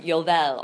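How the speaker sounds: "yovel" said the Hebrew way, YO-vel; Hebrew pronunciation. Shown as a demonstration. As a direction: say "yovel" as the Hebrew word, stress on YO. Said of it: "the Hebrew word for the ram's horn".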